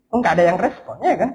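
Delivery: usual speaking voice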